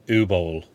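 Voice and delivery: "Uwe" shortened to a plain OO before "Boll"; chosen pronunciation. Shown as a demonstration. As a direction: run "Uwe Boll" together as OO-bowl. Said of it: "I am calling him".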